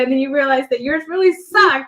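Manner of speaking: ordinary talk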